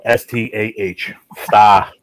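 letters one by one, then "Star" with no R; dropped R. Shown as a demonstration. Man showing her missing R, spelled then said "Stah". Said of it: "We don't pronounce our R's".

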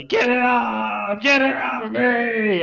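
high, drawn-out panicked scream; frantic high-pitched yell for help; loud scream. Acted. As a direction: scream it loudly, stretching each word out high. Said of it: "He will scream very loudly".

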